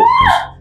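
high wailing cry of outrage; shocked cry. Acted. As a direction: cry out, stunned. Wife cries out in shock.

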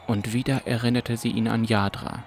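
ordinary delivery, nothing marked

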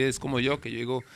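usual speaking voice

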